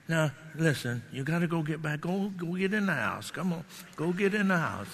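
soft, pleading coax to the dog; meek request. Pretend. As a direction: ask without any authority. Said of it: "she realized I meant business".

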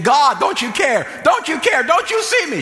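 high, loud retold desperate plea; crying out to God. Woman pacing her apartment, retold crying out to God. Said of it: "crying out to God".

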